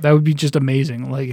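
ordinary talk

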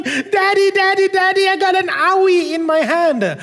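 put-on high whiny child voice, calling Daddy; crying little boy. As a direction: mimic a small boy crying for his dad. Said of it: "crying, crying".

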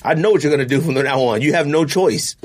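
ordinary talk, nothing marked

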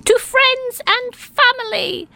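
high, happy group cheer. Acted. Everyone at the feast cheering the toast, high and happy. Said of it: "everyone cheered".

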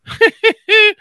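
high-pitched laugh burst. Real, not acted. Laughs at self-deprecating joke.

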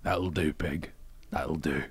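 put-on soft, low Scottish accent. Imitating the movie farmer, quietly in Scottish accent. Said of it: "He did in Scottish".

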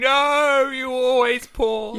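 high shouted No, then a slow insistent you always pause; mock shouted objection. Imaginary listener shouting at the podcast hosts, mock outrage. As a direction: yell it like an outraged listener on a bus. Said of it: "just going".

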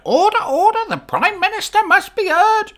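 very high, loud squawking parrot voice; Boris the parrot. Acted. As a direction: play the parrot calling the Commons to order. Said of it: "you're gonna hear Boris the parrot".

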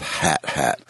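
"hat hat" as a model for how AT-AT sounds; rhyming example. Shown as a demonstration. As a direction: give "hat hat" as two matching short words. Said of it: "supposed to be pronounced as if".

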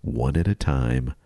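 low, measured mock-sultry read; slow seduction for the ladies. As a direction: draw out each feat as if seducing. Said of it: "read this a little slower for the ladies".

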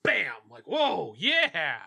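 hammed-up burst of energy; sudden caffeine rush. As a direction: act out coffee kicking in. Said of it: "Like that".

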